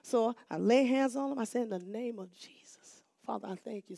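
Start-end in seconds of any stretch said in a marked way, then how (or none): 1.6-4.0 soft prayer. Retold or imitated. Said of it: "something like that".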